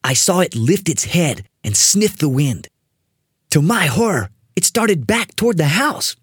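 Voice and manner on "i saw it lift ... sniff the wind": usual speaking voice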